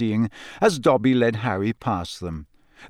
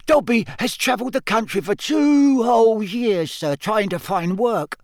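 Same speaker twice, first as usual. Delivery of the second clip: Dobby; 10 semitones higher than usual. A narrator's reading. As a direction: squeak out a tale of hardship. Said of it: "Dobby squeaked".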